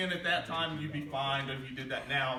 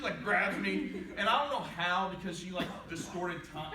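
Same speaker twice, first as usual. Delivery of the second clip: ordinary talk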